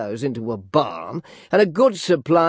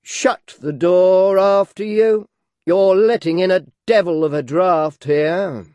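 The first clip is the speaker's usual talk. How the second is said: brusque order; 5 semitones higher, 6 dB louder, slower than usual. A narrator's reading. Gun gentleman orders suitor.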